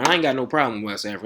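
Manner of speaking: usual speaking voice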